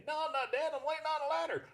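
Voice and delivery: high deadpan horse puppet. Acted. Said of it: "his voice is really close to my normal voice".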